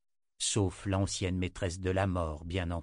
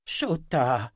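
indignant exclamation, higher and louder; crying out; 4 semitones higher, 5 dB louder than usual. A narrator's reading. Old wizard confronts the seer, crying out. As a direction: call the name out sharply. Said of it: "s'écria-t-il".

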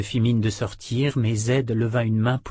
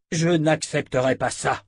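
higher, indignant refusal; indignant cry; 5 semitones higher, 4 dB louder than usual. A narrator's reading. Harold refuses the sorcerer's verdict, indignant cry. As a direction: protest firmly in a raised pitch. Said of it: "s'écria Harold, indigné".

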